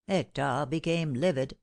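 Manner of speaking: plain reading voice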